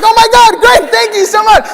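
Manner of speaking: very high, loud gushing thanks; overjoyed. Retold or imitated. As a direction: squeal with delight. Overjoyed at yes.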